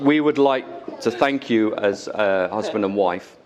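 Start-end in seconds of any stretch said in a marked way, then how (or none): none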